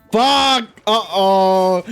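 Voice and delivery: real panicked yell at the game; startled curse. Real, not acted. Player caught out in a fight, startled yell.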